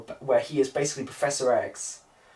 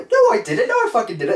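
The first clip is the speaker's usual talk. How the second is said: high, loud outraged denial; indignant denial; 10 semitones higher, 7 dB louder, faster than usual. Retold or imitated. Accused girl denies offering cigarettes, loud high outrage. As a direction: protest shrilly that you didn't. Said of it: "And he goes".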